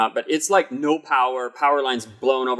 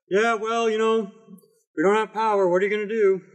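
shrugging Iowan; 9 semitones higher than usual. Retold or imitated. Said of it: "they'd be like".

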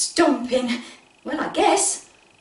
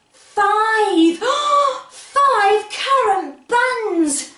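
excited; 9 semitones higher, 3 dB louder, much slower than usual. Acted. Counting reaches five, gasp.

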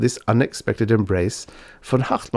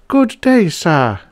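high, loud, stately greeting; lofty lady of the house; majestic cold greeting; 10 semitones higher, 6 dB louder, slower than usual. A narrator's reading. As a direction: greet an intruder with grand disapproval. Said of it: "in gloomy majesty".

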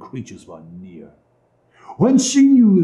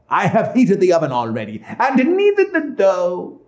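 higher-pitched witch voice; scheming witch; 7 semitones higher than usual. Acted. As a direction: announce it as the witch.

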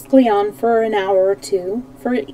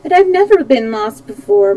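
usual speaking voice

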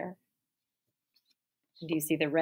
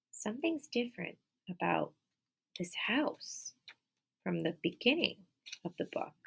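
whisper; 8 dB quieter than usual. Acted. Teasing kids about differences.

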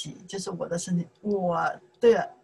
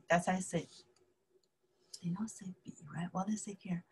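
fast whispered aside; 14 dB quieter, faster than usual. Real, not acted. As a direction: mutter it quickly under the breath. Teacher checks the board.

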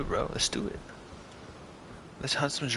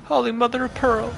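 shocked shout; 13 semitones higher, 4 dB louder than usual. Real, not acted. Shocked by boss attack.

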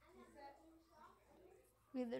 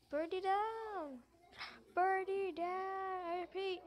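mayday radio call; 3 semitones higher than usual. Pretend. Pretend pilot radio distress.

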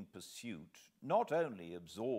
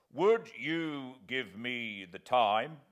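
slow, louder soldier's query; a slow, bluff army sergeant asking; 5 semitones higher, 9 dB louder, slower than usual. Acted. Sergeant voiced in the story.

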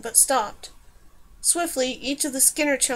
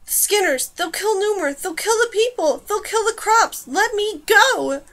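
high, panicked cries getting louder; frantic protest; 8 semitones higher, 5 dB louder than usual. A narrator's reading. Dane fighting the hands that grip her, frantic cries. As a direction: plead in panic, stretching the last words.

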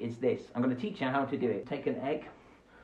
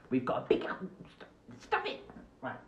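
softer unvoiced hush to the puppet; whispered scolding; 2 semitones lower, 5 dB quieter than usual. Host quietly telling the rat puppet to stop it. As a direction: hiss it under your breath.

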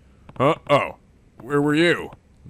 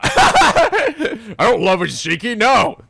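laughing denial; 5 semitones higher, 4 dB louder, much slower than usual. Real, not acted. Flustered denial while laughing.

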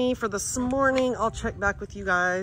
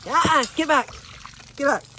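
shooing; 3 semitones higher, 4 dB louder than usual. Real, not acted. Shooing a turkey away.

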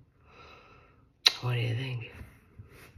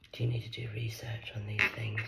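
soft whispered question; whispered jab; 4 semitones lower, 7 dB quieter than usual. Real, not acted. Mocking viewers in a soft whisper.

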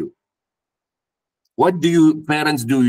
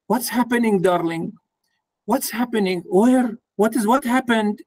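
higher, gentle questioning voice; caring parent; 7 semitones higher than usual. Retold or imitated. Parent gently asking the hurt daughter what happened. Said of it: "So you ask".

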